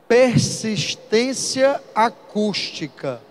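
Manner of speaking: "Persistência acústica" drawn out very slowly, syllable by syllable; slow dictation. Shown as a demonstration. Teacher dictating the term to note down, very slow. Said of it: "Anotem aí".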